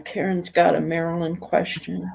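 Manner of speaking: normal talking voice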